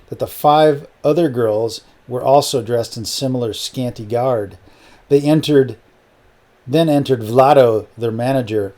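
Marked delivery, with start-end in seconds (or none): none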